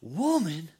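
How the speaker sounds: put-on little girl shocked at being called that; indignant girl. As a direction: play the girl taking offense. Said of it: "She goes".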